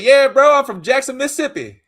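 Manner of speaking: high, loud Mississippi drawl. Retold or imitated. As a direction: answer eagerly in a Mississippi drawl. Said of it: "I had a slight s-, Mississippi drawl".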